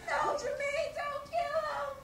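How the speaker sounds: high, slow crying scream; sister's high tearful cry to stop the killing; crying plea. Retold or imitated. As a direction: wail it high and stretched like a sister begging.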